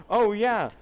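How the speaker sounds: sarcastic imitation of the careless narrator; mock quote. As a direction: throw his words back at him. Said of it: "you were like".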